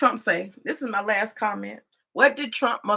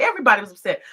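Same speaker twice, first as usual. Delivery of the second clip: normal talking voice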